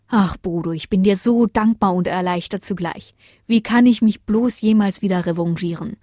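ordinary delivery

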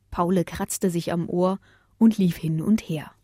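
ordinary delivery

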